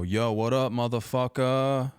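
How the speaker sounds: loud, drawn-out cocky greeting; cocky yell. Retold or imitated. Kid yelling the insult at his friend's dad, cocky shout. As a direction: shout it across the yard like a big shot.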